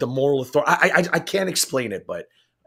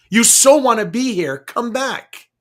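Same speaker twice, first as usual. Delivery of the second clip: mocking taunt; 7 semitones higher, 5 dB louder, slower than usual. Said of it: "I'm like".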